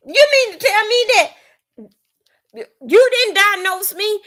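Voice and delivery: hammed-up angry client complaint; mock outraged client. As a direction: play the furious customer who feels cheated.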